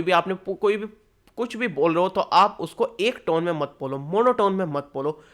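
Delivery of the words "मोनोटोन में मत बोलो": ordinary talk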